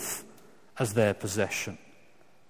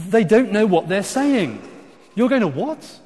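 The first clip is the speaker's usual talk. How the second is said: high-pitched, louder mocking disbelief; incredulous scorn; 8 semitones higher, 6 dB louder than usual. Preacher voicing God laughing at rebel kings, incredulous scorn. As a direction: scoff at an absurd plan, pitch raised high.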